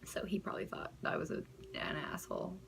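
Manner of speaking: low, fast, whispered; whispered aside. Real, not acted. Admitting she laughed at him, a whispered aside. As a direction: confide it under your breath.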